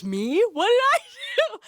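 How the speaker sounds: squeaky high, laughing protest; imitating the singer's feigned innocence; mock innocent. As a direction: play dumb with a sudden high-pitched laugh. Nick's mock-innocent reply in story.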